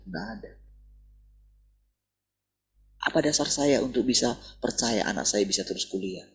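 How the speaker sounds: usual speaking voice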